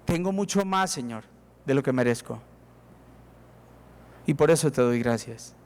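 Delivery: soft, low humble prayer. Pretend. Humble heart speaks to God, soft and low. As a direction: murmur it like a grateful confession. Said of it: "su corazón debería decirle a Dios".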